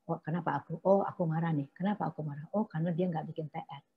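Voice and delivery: soft, reflective murmur to yourself; quiet self-talk. Shown as a demonstration. Mother quietly figures out her anger over undone homework. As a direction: calmly work out why you're angry. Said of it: "tadi kan Anda bilang".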